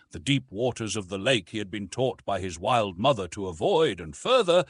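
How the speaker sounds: ordinary delivery, nothing marked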